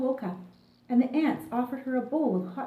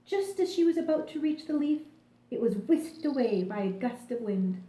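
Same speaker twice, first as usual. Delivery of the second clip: usual speaking voice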